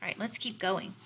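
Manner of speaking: usual speaking voice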